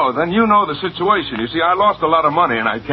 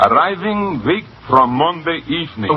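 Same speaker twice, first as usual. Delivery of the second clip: put-on Russian accent; 2 semitones higher than usual. Young American auditioning by reading a cablegram, Russian accent. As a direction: read haltingly in a Russian accent. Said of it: "A Russian accent".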